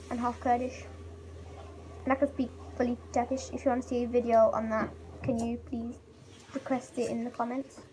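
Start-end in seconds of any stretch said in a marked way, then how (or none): none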